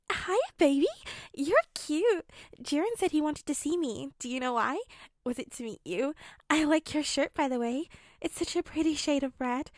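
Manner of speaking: high, sing-song unhinged schoolgirl.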